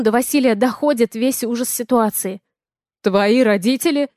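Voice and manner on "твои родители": mildly shouted startled question; understated yell as a man's line; shouting. A narrator's reading. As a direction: cry out in disbelief, not full volume. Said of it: "кричит Вася".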